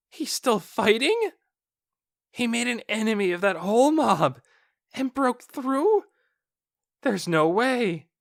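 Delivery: can't believe what he's hearing; disbelieving guard. Acted.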